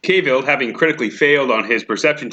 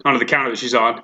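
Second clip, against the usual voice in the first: ordinary talk, nothing marked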